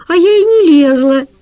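put-on little girl denying it to her parents; indignant little girl. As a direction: shout back like an offended kid. Girl protests to father.

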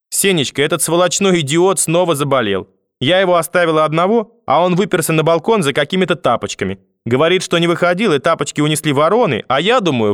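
high-pitched, agitated tattling; grandmother complaining, indignant. A narrator's reading. Grandmother reporting the boy's misdeeds to grandfather, indignant complaint.

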